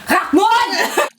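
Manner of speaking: high-pitched shocked yelp, laughing; mock a mom's shocked reaction; startled mom. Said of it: "And she's like".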